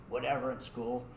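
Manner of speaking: normal talking voice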